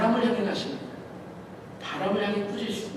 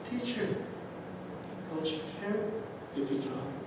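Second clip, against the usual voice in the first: soft, a touch of hurt reproach; hushed complaint; 2 semitones lower, 13 dB quieter, much slower than usual. Acted. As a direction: quietly ask if he even cares. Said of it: "예수님께 말합니다".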